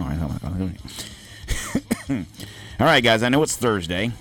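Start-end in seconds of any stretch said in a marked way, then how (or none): 0.0-2.3 low, smooth radio DJ voice. Shown as a demonstration. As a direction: croon it like a late-night DJ. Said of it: "let's do the radio voice".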